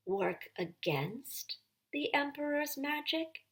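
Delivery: frowning rebuke. A narrator's reading. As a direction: gently warn her off. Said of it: "with something like a frown".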